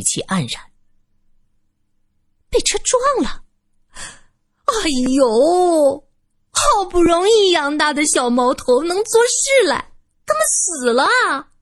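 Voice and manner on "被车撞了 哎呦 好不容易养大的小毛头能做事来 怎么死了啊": 高声拖腔假哭嚎; 拍腿干嚎，哭腔拉长. Acted. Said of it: "老女人拍着腿哭嚎着". (老女人拍腿假意哭嚎)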